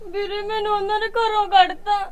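tearful telling; crying. Acted. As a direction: weep it out to your brother. Sister tells brother, weeping.

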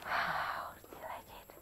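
soft admiring whisper, slow. Real, not acted. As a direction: breathe it out in awe. Admiring finished cup.

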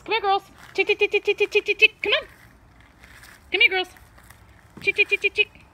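high, loud chicken call. Real, not acted. Calling chickens to come.

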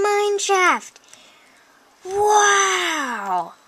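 real high, loud thrilled exclamations; too excited. Real, not acted. Player finds iron ore in the mine shaft, wildly excited. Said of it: "I'm getting too excited about this".

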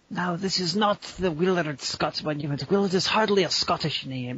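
hammed-up Scottish father; Scottish dad impression. Son imitating dad lecturing about a monument, mock Scottish accent. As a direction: correct them in a put-on Scottish accent. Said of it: "Your dad sounds nothing like that".

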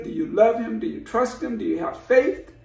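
ordinary talk

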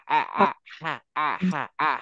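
আ pressed from mid throat where হা is made; throaty ain. Shown as a demonstration. Teacher contrasting হা and ain from the throat. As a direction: alternate হা and a throaty আ. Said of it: "এই হা জায়গা থেকে আইন হবে আ".

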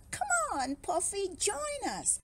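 high, lively invitation; excited calling out. A narrator's reading. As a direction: call a friend over eagerly. Barry calls Puffy to play.

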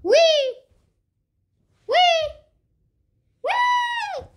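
rising gleeful whee; excited squeal. Pretend. Toy sliding, rising squeals.

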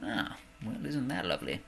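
ordinary talk, nothing marked

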